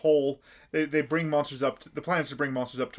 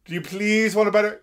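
pushy plea; 7 semitones higher, 6 dB louder than usual. Retold or imitated. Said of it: "It's like".

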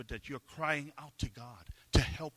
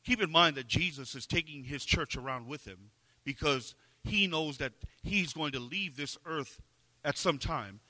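ordinary talk, nothing marked

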